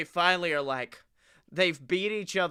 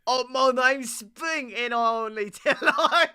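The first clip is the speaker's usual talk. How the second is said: Cockney; 7 semitones higher than usual. Acted. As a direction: play a cartoon Cockney twin. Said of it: "they should be Cockney".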